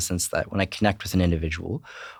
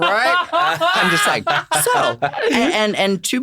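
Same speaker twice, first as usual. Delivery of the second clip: high, laughing and giddy; laughing through the words; 4 dB louder, slower than usual. Real, not acted. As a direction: crack up mid-sentence. Laughing at own chiropractor joke.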